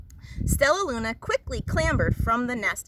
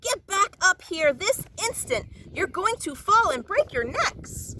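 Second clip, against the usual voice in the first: higher, sharp alarmed scolding; scolding mother; 7 semitones higher than usual. A narrator's reading. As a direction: order the chicks back up at once. Mother scolds dangling babies.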